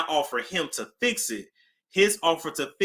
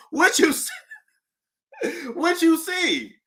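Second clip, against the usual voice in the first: squeaky, laughing shock; half-laughing, half-panicked question; high laughing disbelief; 11 semitones higher than usual. Retold or imitated. Retelling the witch story, high laughing disbelief.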